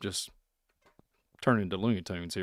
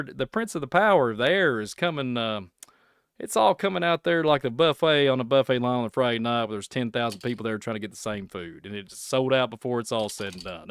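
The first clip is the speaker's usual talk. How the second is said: ordinary talk, nothing marked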